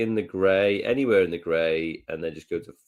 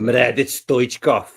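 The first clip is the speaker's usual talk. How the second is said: mock Bulgarian striker name; 2 semitones higher, 5 dB louder, slower than usual. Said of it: "Bulgarian striker name".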